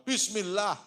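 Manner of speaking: a sudden high, loud gasp of bismillah; startled bismillah. Acted. Masyitoh startled in front of Pharaoh's child, high sudden cry. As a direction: blurt it out as the comb drops. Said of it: "Masyitoh kaget".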